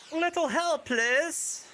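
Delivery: put-on high, shaky stab at a Russian accent; self-admittedly weak Russian accent; attempted Russian accent. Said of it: "I don't know how to do a Russian accent very well".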